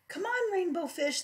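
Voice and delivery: high playful calling voice; lightly voiced little fish calling. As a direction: call out brightly to a friend. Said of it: "they would call".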